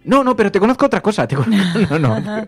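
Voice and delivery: loud, high-pitched imitation of an insistent fan; hammed-up, high and insistent; insistent mock recognition. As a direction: protest excitedly, breaking into a laugh. Acts out insisting on recognising someone.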